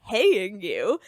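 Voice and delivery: loud, incredulous echo question; acted outburst of disbelief; shocked disbelief. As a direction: throw it back, appalled. Acted shock at learning of payment.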